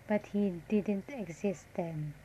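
ordinary talk

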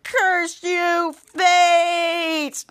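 long-held theatrical cry; melodramatic curse. Acted. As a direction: curse the heavens, drawing out each word. Blindfolded character curses fate.

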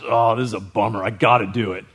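low, sulky mumble of a reluctant servant; grudging complaint. Acted. Grudging servant to the Lord, low sulky groan. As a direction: sigh it out like a chore. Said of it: "Law is".

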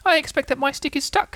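high little-creature voice; fretting Roo. Acted. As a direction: pipe up in a tiny high voice. Roo's put-on voice.

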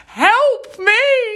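high, whiny cry for help mimicking her friend; mock helpless wail; mocking friend's plea. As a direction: squeal it like a helpless invalid. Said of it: "You were running through Stratford Station going".

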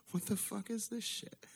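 can't believe this is happening; incredulous. Retold or imitated. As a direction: kid baffled at being picked for the snake. Asked to catch the snake.

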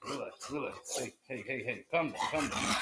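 soft, hushed calming voice; soothing hush. Real, not acted. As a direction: quietly settle an agitated pet. Soothing the agitated Half Sack.

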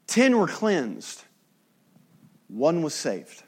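emphatic preaching. Acted. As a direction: land each word slowly. Preaching climax.

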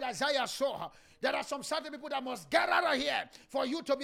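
loud, driving preaching prayer, speaking in tongues; fervent shouted prayer. Real, not acted. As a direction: pray at full force, crying out to God.

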